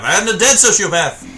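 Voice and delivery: high, loud, drawn-out cry; exclaiming in game. Real, not acted. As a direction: yell it as you get killed mid-match. Yells as the character dies.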